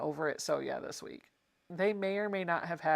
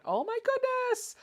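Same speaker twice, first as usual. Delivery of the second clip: delighted squeal. Real, not acted. Admiring a viewer's project.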